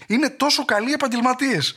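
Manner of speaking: high, mock admiring praise; sarcastic praise. Comic mocking the police as so professional, sarcastic. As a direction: oversell the compliment with a wink.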